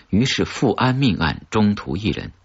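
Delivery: plain reading voice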